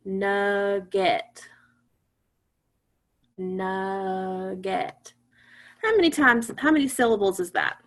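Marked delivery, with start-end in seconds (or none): 0.0-5.1 NUGGET stretched slowly into nug-get on a level pitch; slow syllable-by-syllable. Shown as a demonstration. Teacher has class feel their chins drop, slow and separated. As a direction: draw out each syllable of nugget evenly. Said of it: "say the word slowly".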